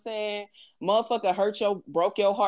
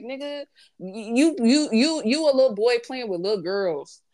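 usual speaking voice

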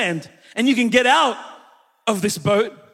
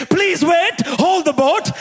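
high, urgent mock plea; pleading dad; 6 semitones higher, slower than usual. Dad calling to family on moving boat, acted high plea. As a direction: call out like a dad being left behind. Dad left behind, begging.

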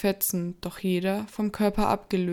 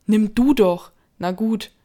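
shouting; 2 semitones higher, 9 dB louder than usual. Acted. André shouting back in fear.

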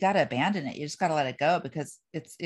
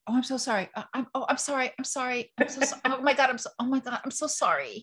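flustered, higher-pitched string of apologies; lightly mocking, higher, babbling sorries; over-apologizer impression; 6 semitones higher than usual. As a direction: play someone who can't stop saying sorry. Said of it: "You over apologize for everything".

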